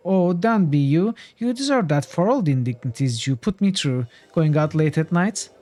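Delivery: high cute put-on scold; hammed-up high nagging wife; mock scolding. Ninny scolds in a cute scowl.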